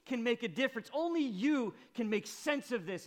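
Mary at Jesus' feet, anguished and loud; anguished cry. Acted. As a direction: cry it out in raw brokenness. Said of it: "as if to say".

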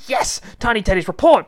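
high, loud order to the troops; commanding call. Acted. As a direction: call the squad to report. Wilma orders the teddies about.

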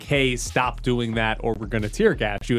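ordinary delivery

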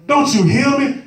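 high-pitched, demanding plea; exasperated disciple. Acted. Disciple pleads with sleeping Christ, exasperated. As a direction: demand to be heard.

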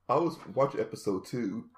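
ordinary talk, nothing marked

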